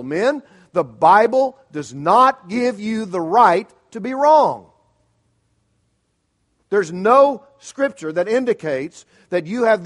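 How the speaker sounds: loud, slow, hammered warning; preaching emphasis. Real, not acted. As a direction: drive every word home to the men.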